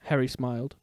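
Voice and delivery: ordinary delivery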